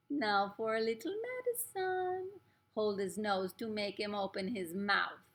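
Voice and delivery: slow, drawn-out witch's command; lightly put-on witch voice, stretching the words. Witch ordering her helpers to dose the boy, menacing.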